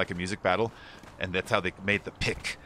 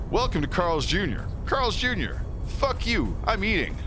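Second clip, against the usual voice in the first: higher, mock-irritated fast-food mascot; pissed-off mascot; 8 semitones higher than usual. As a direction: snap it like an annoyed mascot. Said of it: "Carl's Junior star is all pissed off looking".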